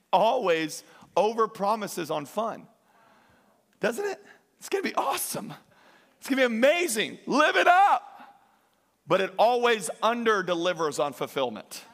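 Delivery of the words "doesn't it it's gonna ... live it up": high, hyped-up tempter voice; mock party hype, much higher; mock excited. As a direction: sell the fantasy, over-the-top thrilled. Sin overpromising, mock excited voice.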